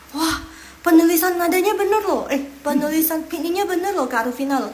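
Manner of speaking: high, loud, impressed exclamation; excited praise. Real, not acted. Teacher amazed Kak Rufina wrote it right, excited. Praising a student's correct writing.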